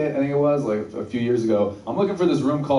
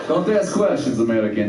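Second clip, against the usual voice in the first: stern mock Russian accent; 4 semitones lower than usual. Kids brush off dad's question, in a Russian accent. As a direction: order it in thick Russian. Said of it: "my kids to get that accent".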